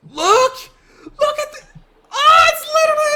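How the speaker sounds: very high, loud, laughing half-sob; laugh-crying shout. Real, not acted. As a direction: shriek in disbelieving despair. Enemy didn't move, outrage.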